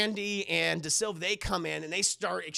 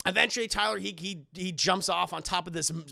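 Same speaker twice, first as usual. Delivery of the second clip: ordinary talk, nothing marked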